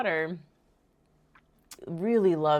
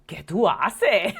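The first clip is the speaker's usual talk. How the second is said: mock exasperated scolding; 5 dB louder, slower than usual. Mom scolds crying daughter.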